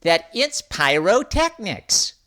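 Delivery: high chirpy bird voice. Acted. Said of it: "chirped Avery the bird".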